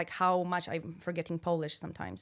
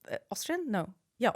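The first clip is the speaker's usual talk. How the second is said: usual speaking voice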